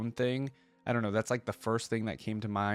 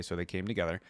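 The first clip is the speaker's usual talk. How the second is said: ordinary delivery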